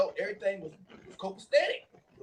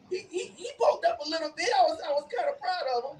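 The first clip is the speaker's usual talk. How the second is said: laughing through the words, higher; 9 semitones higher than usual. Real, not acted. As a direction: chuckle it out while telling. Laughing while retelling.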